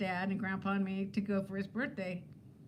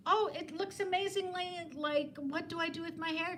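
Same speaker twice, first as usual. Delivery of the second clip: imitating a fan; 8 semitones higher than usual. And she says.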